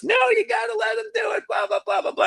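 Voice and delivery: high fast pleading shout; hammed-up insistent yell; insisting shout. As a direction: push hard for him. Widow insisting loudly.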